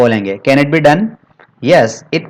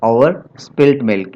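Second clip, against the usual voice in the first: slow dictation; much slower than usual. Shown as a demonstration. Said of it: "क्या कहते हैं?".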